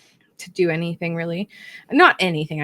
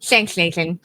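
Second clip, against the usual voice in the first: lisp; 4 dB louder than usual. Shown as a demonstration. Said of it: "You're a good lisper".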